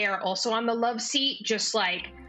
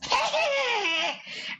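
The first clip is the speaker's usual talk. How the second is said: high, shrieking cackle; witch cackle. Acted. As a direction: cackle like a wicked hag.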